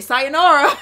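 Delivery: high, very loud mock farewell; playful shouted goodbye breaking into laughter; loud send-off. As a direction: belt it out as a gleeful dismissal. Wishes they had dismissed her.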